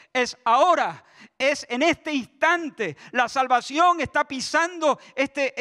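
preacher pressing the listeners that it is now; preaching at full force. Acted. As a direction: drive it home to the congregation.

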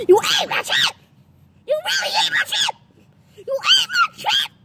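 high screaming, near-crying fury; berserk rage. Acted. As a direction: scream it, voice cracking. Escalating rage over chip.

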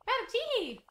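ordinary delivery

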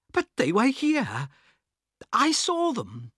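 high, loud stammering disbelief; bewildered protest. Acted. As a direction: insist desperately it happened. Harris baffled by empty carriage.

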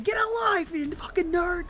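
high, loud insult; heckling yell. Real, not acted. Gamer telling opponent to get a life, heckling.

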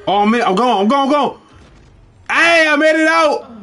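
frantic relief after escaping; being chased. Real, not acted.

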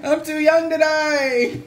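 high, slow wailing plea through laughter; a laughing fake cry of someone doomed; mock terror. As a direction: beg like a hammed-up victim. Attacker begging, mock fear.

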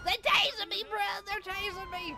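loud frantic complaint; panicked shout. Real, not acted. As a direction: yell it while getting tased. Being tased in game.

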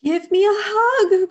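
high, loud eager cry. A narrator's reading. Young bear begging sheep for a hug, crying out. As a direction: call it out high and pleading. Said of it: "cried Douglas".

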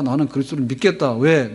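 ordinary talk, nothing marked

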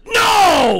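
sudden high scream; screaming Paul Allen. Retold or imitated. Host doing his Paul Allen bit, screaming. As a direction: blast it out like a radio call. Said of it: "It's more of just screaming".

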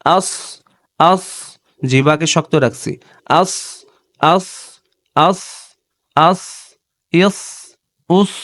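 আস said heavy with a thick, firm tongue; showing the heavy letter; thick sad demo. Shown as a demonstration. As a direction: keep the tongue stiff and thick on আস. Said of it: "তখন দেখেন সদ মোটা হচ্ছে".

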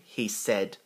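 plain reading voice

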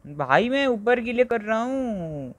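high, slow pleading whine; high slow whine at the game; strained whine. Real, not acted. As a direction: stretch it out, begging the game.

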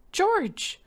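high, loud cry of his name; panicked cry. A narrator's reading. Terrified wife crying out to her husband, high and loud. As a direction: call out to him in terror.

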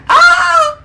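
high, loud mock shriek; piercing copycat scream, played for laughs; imitated scream. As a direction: mimic the other player's startled scream. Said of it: "He screamed too. He was like".

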